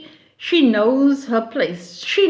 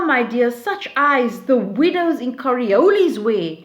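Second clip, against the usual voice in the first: normal talking voice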